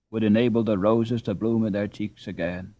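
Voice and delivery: ordinary delivery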